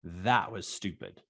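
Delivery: low, soft inner remark; thinking to himself. Retold or imitated. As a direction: say it softly, as a private thought. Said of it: "I thought to myself".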